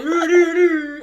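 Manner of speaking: high loud doo doo fanfare; mock trumpet fanfare. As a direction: belt a fanfare. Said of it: "I want to say".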